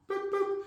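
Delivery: high soft boop boop; a little high dismissal beep; boop sound effect. Acted. Players cut from the roster, soft high boop.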